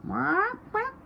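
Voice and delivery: high squeaky quack; high squeaky bird. A narrator's reading. Hen's line.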